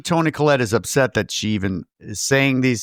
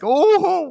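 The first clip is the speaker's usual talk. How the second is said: high drawn-out "ohh"; a high wail of sympathy, played up; moved "oh"; 3 dB louder than usual. Own moved reaction to the sad scene.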